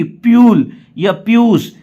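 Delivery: deliberate misreading, "pyool" and "pyoosh" for पुल and पुश; wrong pronunciation. Shown as a demonstration. Teacher warns learners off reading पुल as प्यूल, wrong pronunciation. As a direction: say पुल with a "yoo" glide, as प्यूल, and पुश as पीयूष. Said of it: "ये नहीं कि".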